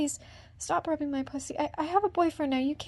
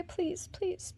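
usual speaking voice

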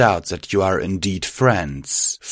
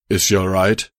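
lightly gruff, not really quiet; a hint of gruffness in a poorly hushed question; gruff, failed whisper. A narrator's reading. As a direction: try to keep a gruff voice down and fail. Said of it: "Steeljack's gruff voice attempt to be quiet fail pretty badly".

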